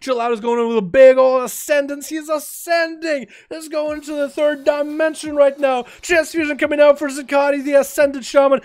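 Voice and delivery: excited esports caster calling the duel; hyped duel commentary; live play-by-play. Acted. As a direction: call the fight live as it happens.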